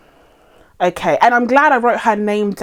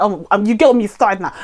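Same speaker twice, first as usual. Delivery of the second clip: ordinary delivery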